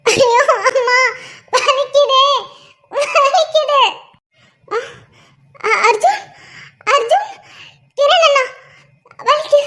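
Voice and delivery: high, loud, sobbing pain cries; crying in pain. Acted. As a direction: wail through the pain, calling for help. Injured woman crying in pain. (வலியில் அழுது அலறுதல்)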